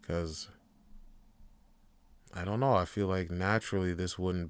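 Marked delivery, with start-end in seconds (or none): none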